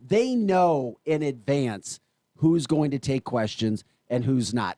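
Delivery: ordinary delivery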